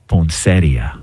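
slow, low mafia-boss delivery; mafia-movie menace. Shown as a demonstration. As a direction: draw it out like a movie mobster. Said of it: "like you just killed someone".

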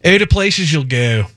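imitated Baltimore accent with exaggerated vowels. Host imitates colleague from Baltimore, exaggerated Baltimore accent. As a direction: mimic a Baltimore local. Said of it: "That's how she talks".

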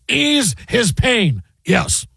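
slow, drawn-out sportscaster impression; Marv Albert. Host redoing a movie line as sportscaster, drawn-out announcer voice. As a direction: stretch each word like a play-by-play call. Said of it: "do it like Marv Albert".

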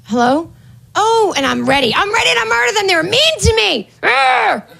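put-on high, hesitant nervous girl voice; timid apologetic woman. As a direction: play a timid woman fumbling with sorry. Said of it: "it would be like".

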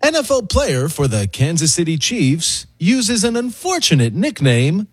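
measured, announcing anchor read; TV news anchor voice. Shown as a demonstration. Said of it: "my best T V news anchor voice".

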